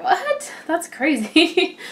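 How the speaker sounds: a sky-high What, then a slow That's crazy; shocked what. Retold or imitated. Reading three pounds gained in a week, mock shock. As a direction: yelp it, then drag it out in disbelief. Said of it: "you're like".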